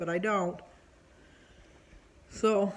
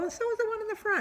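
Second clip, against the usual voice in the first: retold comeback pitched much higher; pointed retort; much faster than usual. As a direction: shoot the point back at him, high and sharp.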